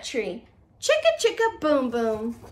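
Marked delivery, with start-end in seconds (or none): none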